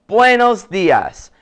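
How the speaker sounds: Buenos dias said slowly and clearly; greeting in Spanish. Shown as a demonstration. Teacher greets his dad with a slow Buenos dias. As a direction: stretch out Buenos dias like a model. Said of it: "I say".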